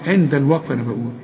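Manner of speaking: ordinary talk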